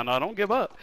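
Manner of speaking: ordinary talk, nothing marked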